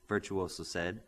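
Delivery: ordinary delivery, nothing marked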